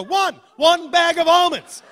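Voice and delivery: slow, high, loud insistence; exasperated answer. Acted. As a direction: spell it out loudly, fed up.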